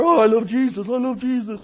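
hungover hypocrite gushing over Jesus, lightly voiced; a touch of syrupy fake devotion; mock pious churchgoer. Pastor mocks hungover weekend partiers with fake piety.

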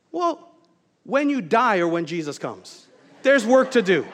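high "Well", then emphatic motherly pronouncement; imitated mother's verdict. Mom answers her tired son, imitated firm verdict. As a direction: build to a loud, punchy mock-maternal order. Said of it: "my mom would go".

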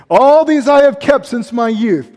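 put-on smug rich young ruler. Preacher voicing the rich young ruler.

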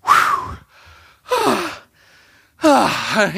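imitated heavy puffs of breath; winded panting. Daughter flagging at the three hundred mark, winded puffing. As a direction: act out a tired runner blowing air. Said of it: "and she's like".

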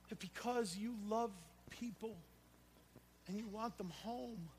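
soft, hushed pastoral prayer; closing prayer. Acted. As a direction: pray quietly and humbly before the congregation.

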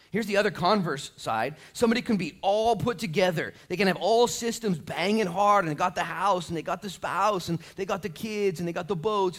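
high, loud, driving preacher voice; full-force preaching. Real, not acted. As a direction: pile up the list with rising force.

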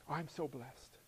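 a soft, mock-pious "so blessed"; a hint of a pious churchgoer, lightly mocked; mock pious church talk. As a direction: gently parody the churchy phrase. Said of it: "people will say all the time".

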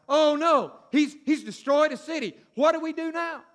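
panicked Philistines. Acted. Philistines panicking about Samson.

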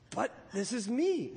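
very much surprised. A narrator's reading. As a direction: protest in mild surprise, voice lifted. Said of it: "very much surprised".

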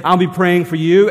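mean every word of it; sincere promise. Retold or imitated. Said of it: "and we do".

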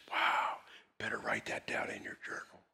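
very soft whispered reply; hushed awe. Retold or imitated. Pastor answering the woman's news, in a hushed whisper. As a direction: keep it down to a whisper.

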